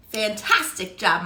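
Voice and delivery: ordinary talk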